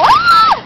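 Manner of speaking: high shrill mock scream of fright; imitated scream. Friend retelling the lizard scare, mock scream. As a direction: shriek like Joyce did when she ran.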